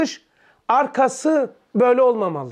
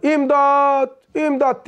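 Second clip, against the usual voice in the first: higher, drawn-out mock call for help; mild acted holler, raised pitch; acted cry for help; 4 semitones higher, slower than usual. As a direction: call out for help, stretching the word. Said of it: "Birisi bağırıyor".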